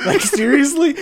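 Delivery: high, laughing disbelief. Real, not acted. Mocking the game's story with friends, laughing. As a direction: laugh it out incredulously. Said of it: "laughing at the game's plot".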